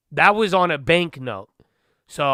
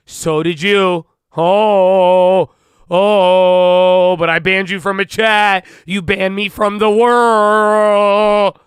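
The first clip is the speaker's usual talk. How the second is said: much louder, higher, hammed-up wounded reply, last line drawn out slowly; mock dramatic chatter; 6 semitones higher, 11 dB louder, much slower than usual. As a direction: play an outraged viewer overacting the hurt.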